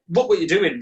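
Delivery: mildly mock an angry housemate's scolding; livid housemate. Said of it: "he was so livid".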